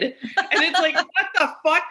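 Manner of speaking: high-pitched, cracking up while talking; real laughing disbelief. Real, not acted. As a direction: let laughter break through the words. Cracks up at killer's house listing.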